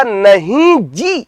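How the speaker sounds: नहीं stretched slowly with a rise; stress on नहीं. Shown as a demonstration. Teacher demonstrating emphatic नहीं for the class. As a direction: lean hard on नहीं in नहीं जी. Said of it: "जोर से बल दिया".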